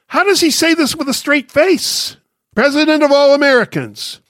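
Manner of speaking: high-pitched disbelief; incredulous. Real, not acted. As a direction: echo the claim with high, indignant disbelief. Mocks a quote from Biden's speech.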